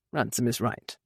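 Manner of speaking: low, soft and flat. A narrator's reading. Duke flatly overruling his niece. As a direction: state it with no give. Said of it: "the Duke told her flatly".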